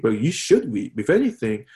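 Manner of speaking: ordinary talk, nothing marked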